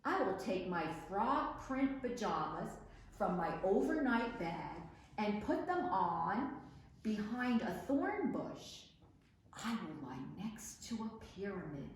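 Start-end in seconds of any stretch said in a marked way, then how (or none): none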